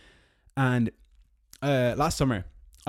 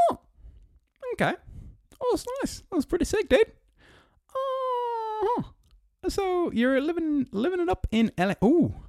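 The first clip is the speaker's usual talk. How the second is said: sarcastic high squeal. Acted. Mocking the co-founder's new mansion, high sarcastic falsetto. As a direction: coo over it with fake delight.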